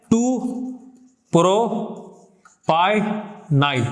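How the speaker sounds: very slow "टू प्रोपाइनाइल", split up; syllable by syllable. Shown as a demonstration. Teacher giving the alternative name, syllable by syllable. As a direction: pause between each piece of "टू प्रोपाइनाइल".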